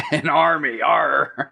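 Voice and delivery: mock pirate accent. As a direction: tease with a pirate growl on "arr". Mocking the odd line as pirate.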